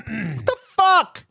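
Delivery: slow, high exasperated what the fuck; exasperated outburst; worked up. Real, not acted. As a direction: let it out, fed up. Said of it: "Yeah, I'm worked up".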